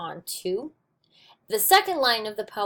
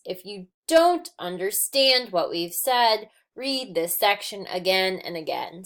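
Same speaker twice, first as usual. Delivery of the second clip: "If you don't understand what we've said, read this section again and again" slowly, stressing every third syllable; anapestic rhythm. Shown as a demonstration. Teacher reading a sentence as six anapaests, measured anapestic rhythm. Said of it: "view it as a series of six anapaests".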